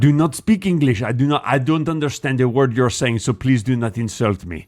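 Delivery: offended put-on French accent; stilted French-accented protest. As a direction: play an affronted French person in English. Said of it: "trying to speak English right now".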